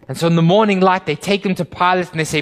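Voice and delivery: loud, high, driving sermon delivery; pulpit-pounding storyteller; fiery preaching. Acted. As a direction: preach it at full force, building urgency. Retelling the trial at full force.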